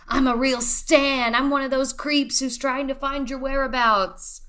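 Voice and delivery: playing the obsessive fan, half joking; creepy superfan. Podcaster mocking herself as a creepy stan, lightly creepy. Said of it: "without seeming like".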